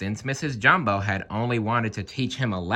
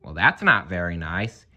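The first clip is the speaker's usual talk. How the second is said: ordinary delivery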